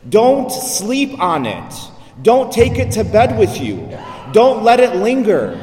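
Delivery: emphatic preacher's warning, rising in force; urgent exhortation. Retold or imitated. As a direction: drive each command home, separately and firmly. Preacher driving home a warning.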